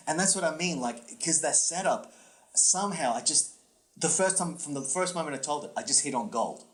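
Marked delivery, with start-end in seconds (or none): none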